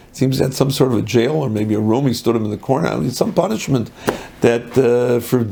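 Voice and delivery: normal talking voice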